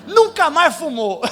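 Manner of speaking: slow, loud, laughing through the punchline; drawn-out laughing punchline. Real, not acted. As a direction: crack up while landing it. Punchline of comic testimony.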